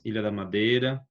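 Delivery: ordinary delivery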